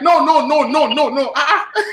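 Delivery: high, rapid-fire mock refusal; retold flustered refusal. Branch manager blocking a customer at the door, flustered refusal. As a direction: imitate a manager waving someone off, pitched high.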